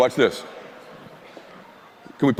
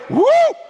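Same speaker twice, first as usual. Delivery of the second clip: sharp high whoop yelped out loud; whoop sound effect; 5 dB louder than usual. Retold or imitated. Telling how the headlight flew off, a loud high whoop. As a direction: yelp a sudden high whoop.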